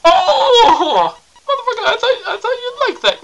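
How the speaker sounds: laughing yelp. Acted. Just slapped by his partner, squealing with laughter. Acting stunned after getting slapped.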